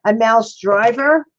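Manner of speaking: ordinary talk, nothing marked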